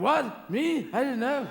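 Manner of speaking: high, loud, startled innocence; clueless God. Retold or imitated. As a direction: play a God caught out, baffled. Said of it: "A God going".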